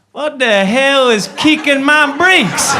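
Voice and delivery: loud, high, slow Tennessee drawl; bewildered Southern holler, Tennessee style; Tennessee accent. Acted. Tennessee viewer baffled by Scottish slang, hammed-up Tennessee accent. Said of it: "Tennessee watching this and be like".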